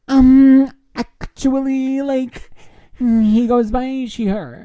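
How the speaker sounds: very high, loud, slow correcting voice; prissy know-it-all. Retold or imitated. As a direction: correct them with smug over-politeness. Dog owner correcting pronouns, acted.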